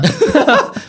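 quick rising laugh; short laugh. Real, not acted. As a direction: laugh at own joke. Laughs after own joke.

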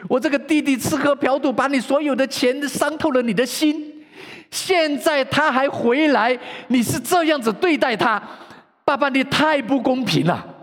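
high, loud, indignant complaint to father; angry outburst. Acted. 大儿子向父亲抱怨. (大儿子向爸爸大声抱怨，愤怒高声)

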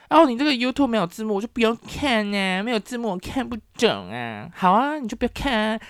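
high-pitched mocking whine; mock complaining. Taiwanese viewers complaining about missing subtitles, mock whiny protest. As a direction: mimic a grumbling viewer in a high voice.

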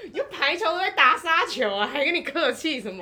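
laughing boast. Real, not acted. Guest laughs as she boasts of her spikes. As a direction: brag through giggles.

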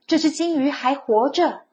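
high, loud eager cry; excited call. A narrator's reading. 孩子兴奋地大喊爸爸. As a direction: call out to Papa in wonder. Child excitedly calling to father.

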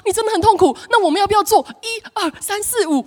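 high, fast, fussing sympathy; mock over-eager comforter. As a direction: gush and rush to fix it. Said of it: "把他痛苦包起来说".

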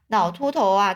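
ordinary delivery, nothing marked

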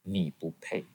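low, hushed inner voice; quiet, deep verdict; very small voice. Retold or imitated. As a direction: say it softly and low, like a voice inside. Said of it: "有一句很小的声音说".

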